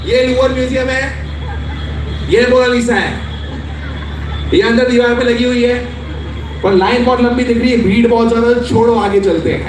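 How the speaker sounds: high, fast, dismissive tour guide in Hindi; impatient tour guide brushing off the tourists; mimicking the tour guide. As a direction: rattle it off like a bored guide waving you on. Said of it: "and I quote".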